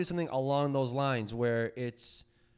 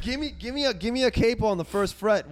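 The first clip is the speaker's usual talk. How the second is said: eager demand; 7 semitones higher, 8 dB louder than usual. Real, not acted. Eager call for a capo.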